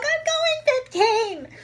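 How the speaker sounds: very high, drawn-out mock whine; high exasperated protest. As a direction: squeal it slowly in exasperation. Protesting to the yelling examiner.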